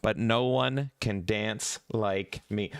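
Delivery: ordinary delivery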